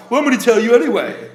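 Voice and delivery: loud, prim gentleman insisting; Buzz Killington voice. Pretend. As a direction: press on like a fussy gent. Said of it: "character of Buzz Killington".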